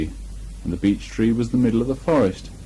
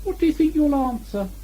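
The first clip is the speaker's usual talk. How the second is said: much higher small Piglet voice. A narrator's reading. Piglet triumphant to Christopher Robin, high and small. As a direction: squeak it out like a tiny piglet.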